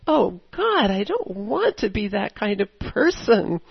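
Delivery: acted anguished plea, loud on the first words; crying out to God. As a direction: wail it mildly, as a retold prayer. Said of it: "I cried out to God".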